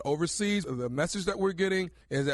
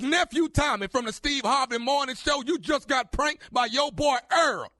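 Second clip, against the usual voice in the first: excited; 7 semitones higher, 3 dB louder than usual. Real, not acted. Prank reveal over music.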